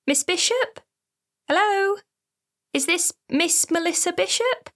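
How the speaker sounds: a nurse checking who answered; nurse on phone. Acted. Narrator voices woman on phone.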